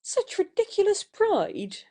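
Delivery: indignant outburst at his pride; stamping her foot. Acted. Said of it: "Stamping her foot".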